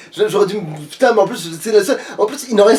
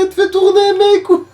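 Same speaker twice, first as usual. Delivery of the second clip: witch voice; 8 semitones higher than usual. Acted. Witch voice kept in play.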